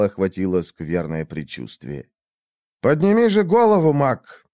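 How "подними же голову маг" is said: loud, high royal order; commanding order. A narrator's reading. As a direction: order him to look up. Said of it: "велел Шах".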